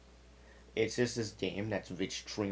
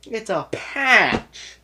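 high, loud, drawn-out outburst; exasperated exclamation; 13 semitones higher, 13 dB louder, much slower than usual. Retold or imitated. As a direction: shout it slowly, stretching the key word. Exasperated punchline of his story.

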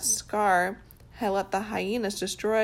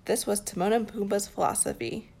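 plain reading voice

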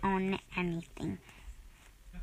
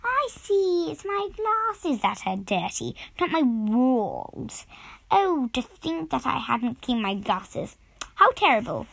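a tidy lady realising her mistake; prim Little Miss Neat, dismayed; 3 semitones higher, 3 dB louder, slower than usual. Acted. Reads Little Miss Neat's lines.

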